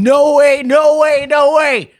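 high, loud, thrilled shouts; a kid overjoyed at an unlock, shouting; excited kid. Acted. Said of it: "Very excited".

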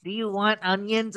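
ordinary delivery

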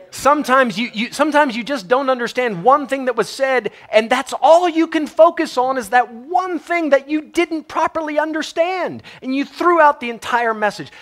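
high, loud Texas preacher twang; Texas preacher accent. Acted. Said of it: "It's just a different accent".

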